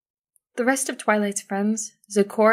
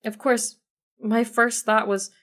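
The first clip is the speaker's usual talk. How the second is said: ordinary delivery, nothing marked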